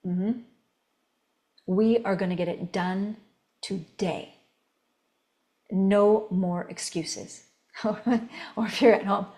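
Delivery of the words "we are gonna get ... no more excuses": low, soft, deliberate command; quietly firm, each phrase falling at the end; soft commander. Shown as a demonstration. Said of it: "you can do it softly".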